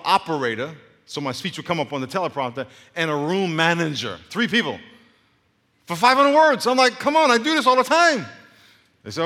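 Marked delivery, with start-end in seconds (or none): 5.9-8.3 high, loud incredulous outburst; hammed-up loud indignation; exasperated protest. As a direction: protest at full volume, pitched way up. Acted exasperated protest to staff.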